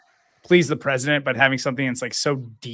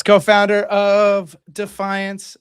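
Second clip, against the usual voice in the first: slow announcer intro; 5 semitones higher, 7 dB louder, much slower than usual. Acted.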